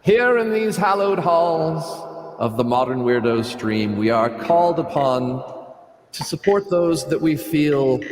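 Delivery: grand hammed-up announcer oration; pompous senator oratory. Mock grand oration plugging sites.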